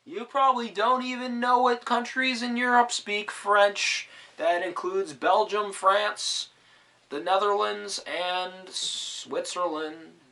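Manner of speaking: high, hammed-up smug lecturer; mock know-it-all. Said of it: "mock lecturer voice to close".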